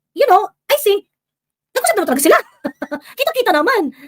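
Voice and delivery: high, loud and laughing; laughing disbelief. Real, not acted. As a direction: laugh it off as obvious. Laughing at obvious meeting. (natatawang sabi)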